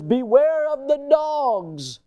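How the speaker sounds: high, drawn-out warning cry; stern warning. Acted. Preacher quoting Paul's warning to the Philippian church, drawn-out warning. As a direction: stretch the words out high, like sounding an alarm.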